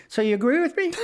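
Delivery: high, loud mock questioning; oblivious older passenger. Older passenger questioning the comedian, high loud oblivious voice. Clueless passenger replies, retold.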